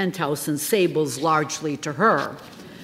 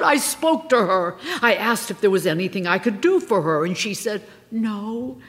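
higher, lightly voiced star recounting; Margola telling her tale; 7 semitones higher, 5 dB louder than usual. A narrator's reading. Star confiding her story to a friend in the car, lightly higher voice. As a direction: tell it as the aggrieved leading lady.

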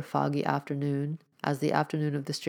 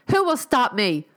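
louder, higher defiant cry; angry cry; 7 semitones higher, 7 dB louder than usual. A narrator's reading. Girl defies the stranger, angry and loud. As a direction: hurl the question back at him. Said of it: "she cried angrily".

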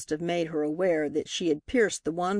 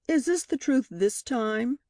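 higher-pitched, unpleasant challenge; 6 semitones higher than usual. A narrator's reading. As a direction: question the witness with pointed unpleasantness. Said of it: "he asked unpleasantly".

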